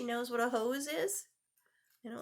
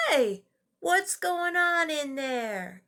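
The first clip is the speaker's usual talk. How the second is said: louder, slow call through a door; mother calling from another room; mother calling upstairs; 5 semitones higher, 7 dB louder, slower than usual. Acted. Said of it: "called Zach's mother".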